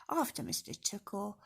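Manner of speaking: ordinary delivery, nothing marked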